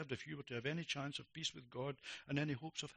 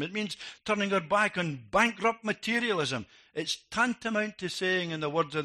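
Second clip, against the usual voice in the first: louder, building sermon delivery; forceful preaching; 7 semitones higher, 10 dB louder than usual. Acted. Preacher calling the congregation to repentance, forceful. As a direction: drive each point home from the pulpit.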